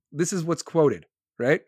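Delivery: ordinary talk, nothing marked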